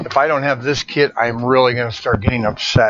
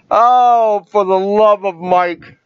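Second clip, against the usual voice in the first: exasperated exclamation; 7 semitones higher, 5 dB louder, slower than usual. Real, not acted. Can't find the Lego kit.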